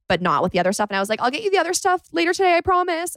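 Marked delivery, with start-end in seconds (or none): 1.2-3.1 quick, very high placating voice; high-pitched promise. Retold or imitated. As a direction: rush the promise in a squeaky high voice. Quoting own promise to the producer.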